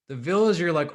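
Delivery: ordinary talk, nothing marked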